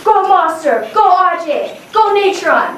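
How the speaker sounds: high, loud cheering of names; hype-man cheer. Retold or imitated. Teacher cheers kids by nickname, high and loud. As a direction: cheer each kid on.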